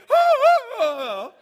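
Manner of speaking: very high, loud shrieked oh, oh; imagined girl's squealing panic, high and loud; shrieking panic. Retold or imitated. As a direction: shriek like a wasp's gone up your skirt. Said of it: "her immediate reaction is gonna be".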